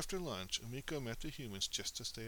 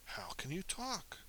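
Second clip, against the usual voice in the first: higher, slower, amazed; astonished question; 8 semitones higher than usual. A narrator's reading. Moose and humans stunned at each other, amazed. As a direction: gasp it out in disbelief. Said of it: "all said at the same time".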